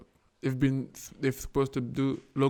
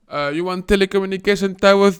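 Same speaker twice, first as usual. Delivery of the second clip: higher, louder skeptical president; a hammed-up suspicious president, louder; mock president; 7 semitones higher, 10 dB louder than usual. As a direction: sneer at the request as a greedy official. President voiced in mock scene.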